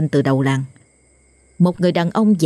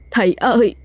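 desperate begging; 6 semitones higher than usual. Acted. The collapsed wife cries out to the exorcist, wailing. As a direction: wail a desperate plea.

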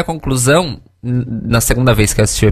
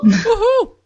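cheering. Pretend. Cheering the announced drag queen.

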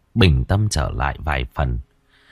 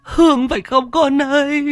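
high, tearful mother's wail; grieving mother crying out for her child; sobbing plea, much higher. Acted. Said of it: "Chị Trực mếu máo".

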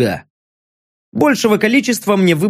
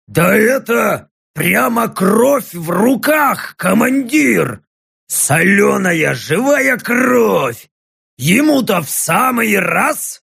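excited orc; 3 semitones higher, slower than usual. Acted. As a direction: gush about the brine like a thirsty orc. Орк в восторге от рассола. (восторженный орк)

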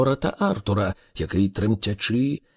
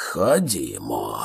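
very low, slow, bull-like growl; bellowing; 13 semitones lower, slower than usual. A narrator's reading. Thor accepts the fight like an enraged bull, bellowing. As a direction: answer in a deep, drawn-out rumble. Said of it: "ревнув Тор".

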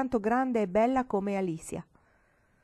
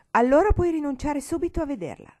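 higher, louder flat refusal; unyielding exclamation; 8 semitones higher, 9 dB louder than usual. Acted. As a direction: shut the matter down for good. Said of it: "esclamò la nonna irremovibile".

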